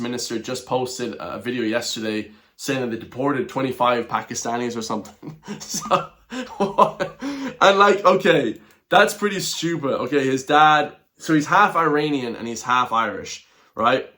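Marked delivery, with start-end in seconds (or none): 6.3-7.6 a sudden high laugh that breaks out; can't hold back a laugh; high burst of laughter. Real, not acted. Laughing hard at the clip's claim.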